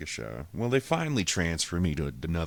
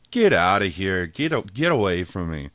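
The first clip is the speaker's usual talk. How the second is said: mock angry brush-off; 3 semitones higher, 8 dB louder than usual. Telling cops to leave.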